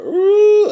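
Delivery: imitated bawling wail, high and loud; mock sob cry; high wailing ooh. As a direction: let out a high crying wail. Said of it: "I just bawled".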